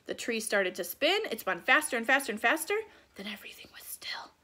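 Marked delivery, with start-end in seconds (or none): 3.1-4.3 whispered and much softer; hushed whisper. A narrator's reading. Narrator telling children the tree stopped spinning, whispered. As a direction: breathe the words to a hush. Narrator marks the sudden stillness.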